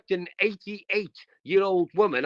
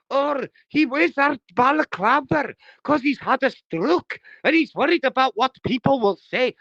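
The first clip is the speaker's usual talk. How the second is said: high, louder sneering imitation of a rival; mocking Humble; 6 semitones higher, 4 dB louder than usual. Streamer mocks Humble's excuse for Dave, sneering. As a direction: mimic him as a whiny excuse-maker. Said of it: "Humble turned round and goes".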